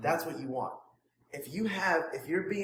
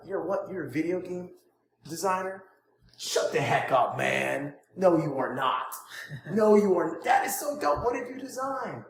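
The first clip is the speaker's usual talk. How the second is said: enthusiastic disbelief; 2 semitones higher, 5 dB louder than usual. Shown as a demonstration. Coach showing a student how to hype a prospect, loud and amazed. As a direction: act amazed and thrilled by their job. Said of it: "it's a rapport builder if you're enthusiastic".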